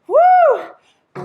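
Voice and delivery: high sustained "woo"; effort whoop. Real, not acted. Coach finishing a hard set, high whoop. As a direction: whoop through the burn. Exertion near end of set.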